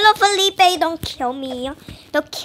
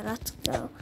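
normal talking voice

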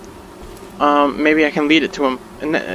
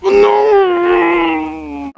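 high, loud cry of despair; panicked yell; 13 semitones higher, 5 dB louder than usual. Real, not acted. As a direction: scream as the bear gets you. Bear attacks, he dies.

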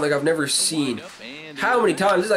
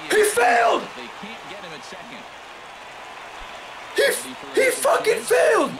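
real shout; 11 semitones higher than usual. Real, not acted. Gamer yelling as the fielder fails the catch. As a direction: yell it out in triumph. Outfielder misses catch, he yells.

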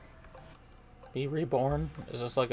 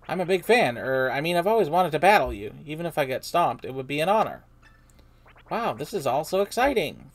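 loud, eager fan voice; excited fan; 5 semitones higher, 9 dB louder than usual. Acted. Buzzy Beetle meets Mario as an excited loud fan. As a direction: gush at your hero, loud.

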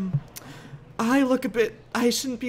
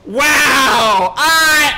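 loud, stretched-out exclamation; outraged shout; 2 semitones higher, 8 dB louder than usual. Acted. Guest reeling from the dress code scolding, outraged shout. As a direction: holler it in disbelief. Outraged after being sent away.

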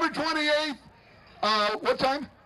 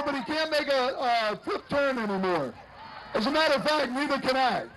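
ordinary delivery

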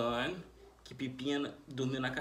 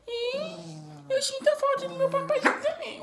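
agudo, lento, meio cantado; dê voz ao cão com choro fingido; voz do cachorro; 7 dB louder than usual. Pretend. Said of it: "Eu sou lobinho Catatal". (voz fininha de cachorro dublado)

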